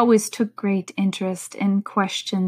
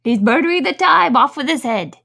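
loud, high royal bawl; 6 semitones higher, 8 dB louder than usual. Acted. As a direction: bawl it out like an angry queen. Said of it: "bawled out".

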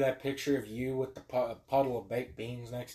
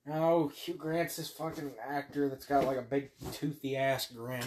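usual speaking voice

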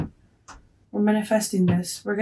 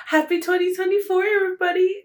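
excited high greeting; 10 semitones higher than usual. Real, not acted. New Year greeting.

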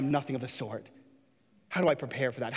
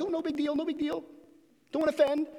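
much higher, airy, dismissive voice; mock shrugging God; 10 semitones higher, faster than usual. Preacher mocking a God who shrugs off sin, high and breezy. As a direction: toss it off like it's nothing.

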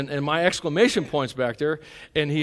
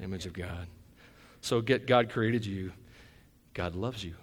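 much softer and lower, gentle; tender reassurance; 7 semitones lower, 6 dB quieter than usual. Real, not acted. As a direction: reassure each listener quietly.

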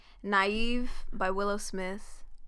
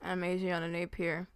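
ordinary talk, nothing marked

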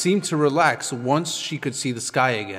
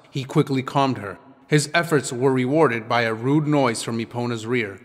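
ordinary delivery, nothing marked

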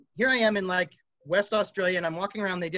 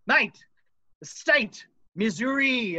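higher, louder Australian voice for "Mate, the state, Missouri"; Australian accent; 4 semitones higher, 3 dB louder, much slower than usual. Retold or imitated. Retelling the Australian busker explaining the instrument's origin, in an Australian accent. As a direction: imitate the busker's Aussie correction, a bit exasperated. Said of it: "and he's like".

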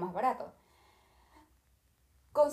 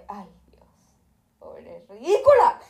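scornful jab; 12 dB louder, slower than usual. Retold or imitated. Mocking the rude airline agent, slow loud sneer. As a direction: drag out the insult with contempt.

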